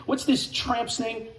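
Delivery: ordinary talk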